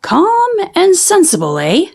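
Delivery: high, knowing jab at Papa; wry teasing. Acted. Mama teasing Papa about the excited cubs, wry. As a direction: toss his words back with a smirk. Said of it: "she said, looking at Papa".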